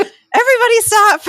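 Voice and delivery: a sudden high laughing shout to halt a fight. Retold or imitated. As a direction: break up the shouting match, laughing as you yell. Therapist halting a couple's shouting match.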